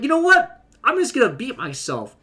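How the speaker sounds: pops up high on "You know what", then drops back; a quick fed-up decision, half acted; mock sudden resolve. Edelon deciding to fight himself, mock resolve. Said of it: "he's like".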